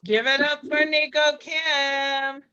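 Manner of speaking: high, loud shout-out to the crowd; announcer's call. Real, not acted. Host rallying the slam crowd for a poet, announcer's call. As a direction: call up applause for the performer.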